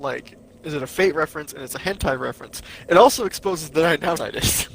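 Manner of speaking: normal talking voice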